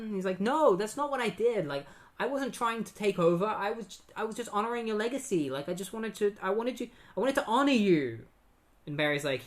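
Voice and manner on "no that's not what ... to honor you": high, hurried mock-distressed denial; pleading protest. Wally defends himself to accusing Barry, pleading. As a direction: plead your innocence, stumbling over restarts.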